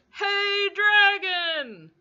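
very loud, high hey, dragon; loud shout. Acted. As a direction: yell into the dragon's ear. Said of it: "shouted as loud as she could".

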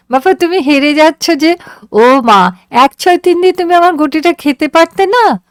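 put-on high, gleeful girl's voice, very high at the end; a delighted child scolding playfully; teasing daughter. A narrator's reading. Daughter teasing father who is losing at ludo, high and gleeful.

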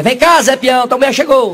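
high, loud call across heaven; a hammed-up summoning yell. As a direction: Saint Peter shouts for Zé. Saint Peter calls the husband.

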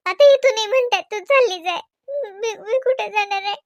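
loud, high, desperate plea; distressed pleading. Acted. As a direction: cry out that she has nowhere to go. Distraught daughter-in-law pleading. (हताश होऊन मोठ्याने विनवणी करणे)

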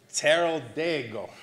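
Terra Dego with the emphasis on the first E of Terra; stress on Terra. Shown as a demonstration. Teacher shows the class how to say the wine, stress on Terra. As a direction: lean on the first syllable of Terra. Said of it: "with the emphasis on this".

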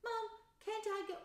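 put-on high, whiny child begging; pleading little girl. Ginny begging her mum to go, high and pleading. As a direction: beg like a small girl left out.

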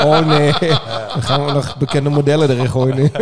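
laughing loudly through the words; a real laugh breaking into the line; amused outburst. Real, not acted. Laughing at the models talk.

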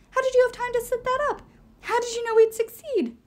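high, loud startled questions; alarmed disbelief. Acted. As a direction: ask in shock.

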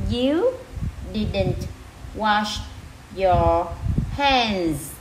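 each word alone, a clear pause between. Shown as a demonstration. Teacher rereads the sentence for learners, word by word. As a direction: read it out one separate word at a time. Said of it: "[name] sẽ đọc lại từng từ nha".

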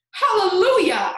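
loud, high, exultant cry; jubilant shout. A narrator's reading. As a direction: shout it like a rejoicing. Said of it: "Aunt Spiker shouted".

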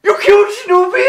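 put-on high, loud, quick question in a German accent; thrilled, incredulous shout; astonished delight. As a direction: squeal it in excited disbelief. Kaiser reacts to the news.

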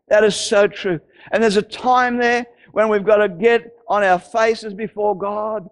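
usual speaking voice

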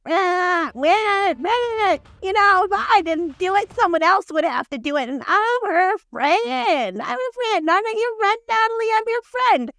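high, hammed-up wailing mom voice; mock whining. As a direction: whine in self-defence like a wronged granny. Mimics Colty's mom whining in self-defence.

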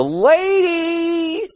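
long, high-sliding "ladies"; drawn-out mock cheer. Comic hyping up Scandinavia, drawn-out mock cheer.

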